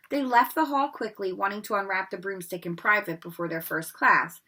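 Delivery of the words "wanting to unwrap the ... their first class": usual speaking voice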